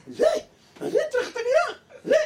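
very high, loud, laughing scolding; shrieking wife. Acted. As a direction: scold him in a piercing shriek. Wife yells at husband. (צעקה גבוהה מאוד של אישה נוזפת)

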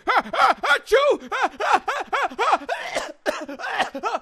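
ah-ah-achoo, big and theatrical; hammy sneeze. Acted. Sneezes at open window.

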